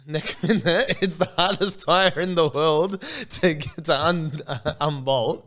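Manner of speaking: slowed start, then loud laughing outburst; laughing exasperation. Real, not acted. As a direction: laugh through the complaint as it builds. Laughing at his own bad luck.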